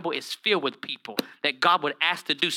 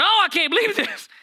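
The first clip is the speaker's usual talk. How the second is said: shocked Adam; 13 semitones higher than usual. Acted. Adam's shock after eating fruit.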